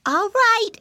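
high, loud piglet agreeing; little pig voice. Acted. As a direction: chirp it like a piglet.

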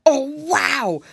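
a touch of a burned fox yelping; lightly voiced fox startled by heat; mild pained fox cry. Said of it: "cried the fuzzy creature".

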